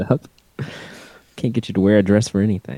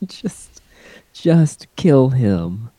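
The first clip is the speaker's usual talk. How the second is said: drawn out; 3 semitones higher, 4 dB louder, much slower than usual. Real, not acted. Slow emphatic echo.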